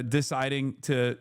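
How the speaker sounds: normal talking voice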